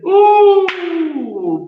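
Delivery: long high "oh", falling away; delighted exclamation. Acted. Acting out a listener's delight at being encouraged, high drawn-out exclamation. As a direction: draw out a high, loud, delighted "oh".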